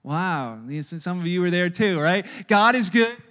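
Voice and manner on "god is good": loud call to the congregation. Real, not acted. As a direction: call it out to the room. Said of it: "congregation call".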